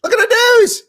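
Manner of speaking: high, loud mock villager shriek; squealing comic crowd voice; Monty Python witch-hunt quote. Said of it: "I did a little quote from Monty Python".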